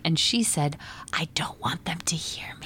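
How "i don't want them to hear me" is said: soft unvoiced whisper of a scared child. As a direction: breathe it out, afraid to be heard. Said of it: "But why are you whispering?".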